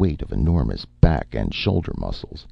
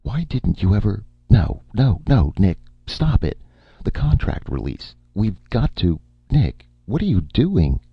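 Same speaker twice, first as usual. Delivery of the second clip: flustered protest; 7 semitones higher than usual. A narrator's reading. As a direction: push him off in a panic. Erica protesting Martin's grab.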